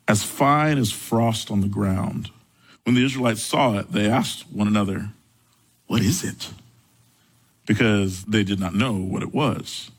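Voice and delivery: low, measured Bible reading; scripture reading. Acted. Pastor reading Exodus aloud to the congregation, measured and low.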